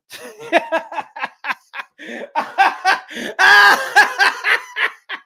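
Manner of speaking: long, high cackle; high-pitched laughing fit. Real, not acted. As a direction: laugh helplessly at your own joke. Cracks up at own joke.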